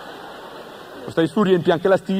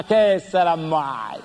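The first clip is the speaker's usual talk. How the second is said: low, slow, lazy sister; 3 semitones lower, much slower than usual. Acted. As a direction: drag it out like a bored sister. Sorella con voce bassa lenta. (sorella svogliata, lenta e bassa)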